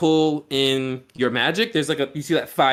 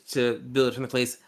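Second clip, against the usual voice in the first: normal talking voice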